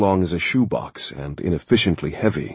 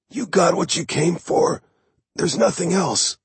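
wounded man; 11 semitones higher than usual. Acted. Wounded dealer pleads.

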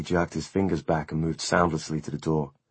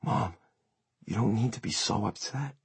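gentle, softer, slightly lifted voice; soft and soothing; 5 semitones higher, 6 dB quieter than usual. A narrator's reading. Vampire son calming his terrified mother, soft and soothing. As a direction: soothe her softly. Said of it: "soft and soothing".

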